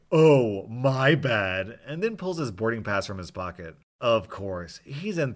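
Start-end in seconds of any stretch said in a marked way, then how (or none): 0.1-1.8 belly laughing. Retold or imitated. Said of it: "starts belly laughing like".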